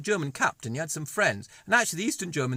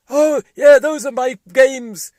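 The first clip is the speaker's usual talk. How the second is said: high, loud brash puppet boasting; a pompous puppet showing off to kids; high loud cartoon tycoon; Donald puppet voice; 8 semitones higher, 16 dB louder, slower than usual.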